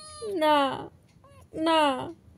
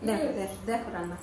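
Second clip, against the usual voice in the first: soft, low cooing to the pet; pet talk; 4 semitones lower, 10 dB quieter, faster than usual. Real, not acted. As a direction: coax the little one gently. (পোষ্যকে নরম গলায় আদর করে বলা)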